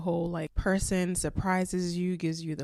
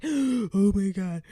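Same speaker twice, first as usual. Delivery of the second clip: retold sobbing; 3 semitones higher, 5 dB louder, much slower than usual.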